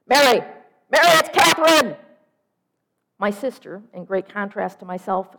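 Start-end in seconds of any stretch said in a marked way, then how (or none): 0.1-2.0 high, full-volume phone yell; yelling to wake someone up; loud yelling. Acted. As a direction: shout it down the line as the loud sister. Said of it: "talks loudly at all times".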